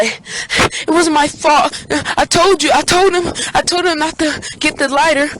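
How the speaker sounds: high, mock tearful whine; whiny pleading. Friend blames narrator before parents, whining tearfully.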